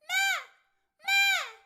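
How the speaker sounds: high, loud duck voice on the note. Shown as a demonstration. Coach leading students to hit the note twice. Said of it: "get on your duck voice and do this with me".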